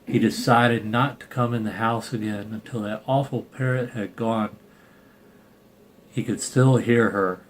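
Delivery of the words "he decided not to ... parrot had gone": usual speaking voice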